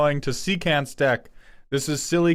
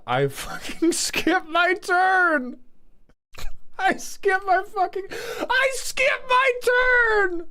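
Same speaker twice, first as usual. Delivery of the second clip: higher, slow, hammed-up wail with a laugh; mock despair; slower than usual. Streamer playing the deck, wailing in mock despair about skipping turns. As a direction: wail it like a tragedy.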